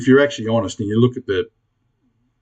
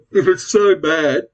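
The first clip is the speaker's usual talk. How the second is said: words stretched by a real yawn. Real, not acted. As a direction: yawn through "if it's so bad". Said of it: "sorry for yawning".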